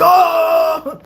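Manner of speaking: high, loud drawn-out gasp of "oh"; mock amazed recognition. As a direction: imitate someone suddenly realizing who you are. Fans' reaction once it clicks.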